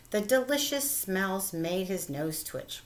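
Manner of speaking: plain reading voice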